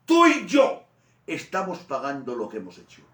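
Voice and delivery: very high, loud rebuke; raising his voice. Acted. Good thief rebukes the other, shouting past Jesus. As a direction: shout across the cross to silence him. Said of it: "tuvo que levantar la voz".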